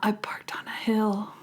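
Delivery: soft, breath-only retold line; acted hushed whisper; stunned whisper. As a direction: whisper it as a dawning realization. Acting out a stunned character's reply.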